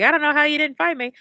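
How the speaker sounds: high, rapid mock-innocent quote; feigned innocence. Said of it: "just be like".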